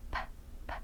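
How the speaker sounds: P, p as soft puffs of air; teacher isolating the unvoiced P; voiceless p sound. Shown as a demonstration. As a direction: pop P without voice. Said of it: "Som do P não tem vibração".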